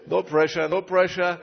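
loud, high, wry irony; ironic no pressure. Acted. The preacher joking about the sickest patients placed before him, loud and wry. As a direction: say it tongue in cheek.

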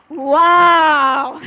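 put-on a loud, amazed old matriarch's wow; a grand old woman marvelling out loud. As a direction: boom it out like a proud old matriarch. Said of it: "sitzen wie eine alte Patriarchin und sagen".

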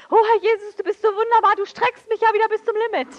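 high, loud, gushing sham-holy voice with a laugh; mock pious prayer. Preacher mocking a fake-pious prayer to the congregation, hammed-up and gushing. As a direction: ham up an over-sweet churchy prayer. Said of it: "religiös zu werden. Nichts schlimmer als".

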